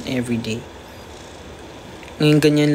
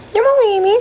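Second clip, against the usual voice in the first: squeaky pet talk; 7 dB louder than usual. Real, not acted. Talking to her cat.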